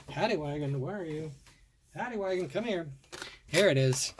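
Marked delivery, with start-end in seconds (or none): 0.1-2.9 soft, higher sing-song call, like calling a pet; coaxing a lost toy. Pretend. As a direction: softly coo for the toy car to come out.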